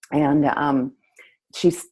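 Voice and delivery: ordinary delivery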